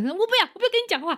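high, fast, furious refusal; child's shouted refusal; angry child. Retold or imitated. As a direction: spit it out like an enraged kid. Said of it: "气在头上".

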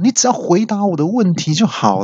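high-pitched, insistent protest; exasperated cry. Acted. User refusing the assistant's offer, high exasperated cry. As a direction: raise the voice high in impatience. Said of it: "我就大叫说".

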